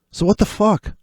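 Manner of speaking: high, disbelieving what the fuck; exasperated outburst. Real, not acted. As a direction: throw up your hands at the chart. Exasperated at market reversal.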